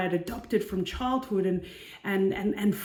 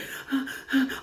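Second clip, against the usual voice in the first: gasp; 5 semitones higher than usual. Retold or imitated. Reenacting craving validation online.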